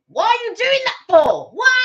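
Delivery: high, loud mock complaint; hammed-up squawk of annoyance; mock exasperation. Once the candy floss is removed, mock exasperated yelling. Acts out exasperation after romance fades.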